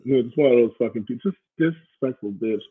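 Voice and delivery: ordinary talk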